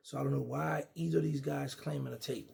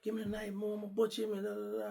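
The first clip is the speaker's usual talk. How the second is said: higher, heated street voice; young hothead voice, pitched up, demanding the knife; DC youngster talk; 8 semitones higher than usual. Retold or imitated. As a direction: mimic a hot-headed DC youngster. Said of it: "That's how the D C, uh, youngsters talk".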